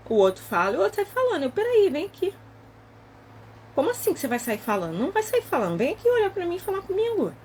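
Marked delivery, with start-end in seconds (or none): 1.5-7.3 stern call back. Retold or imitated. Confronting husband who walks off talking.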